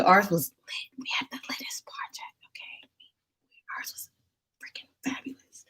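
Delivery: hushed, confiding whisper; whispered aside. Real, not acted. Bragging about the class dance, whispered aside. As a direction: whisper it, barely any voice.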